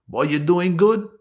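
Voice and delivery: high, loud Trinidadian lilt; Trinidadian accent. Shown as a demonstration. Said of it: "How about Trinidad?".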